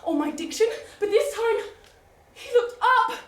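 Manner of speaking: high, loud and breathless, gushing over him; a swooning heroine racing through her confession; giddy lovestruck lady. Acted. Heroine's breathless proposal monologue.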